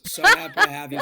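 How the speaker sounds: high, loud gushing welcome; excited. Pretend. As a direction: gush over the newcomer. Mock welcome to Bachelor Nation.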